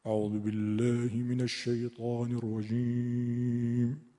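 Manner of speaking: slow low chanted Quran recitation; Abdul Basit recitation. Retold or imitated. Teacher imitating Abdul Basit reciting for students. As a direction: chant it slowly like an Egyptian reciter. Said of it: "عبدالباسط رو ببینید".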